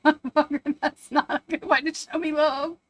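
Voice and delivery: hammed-up disgusted scolding; mock scolding. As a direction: scold the dogs half-laughing. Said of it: "I was like".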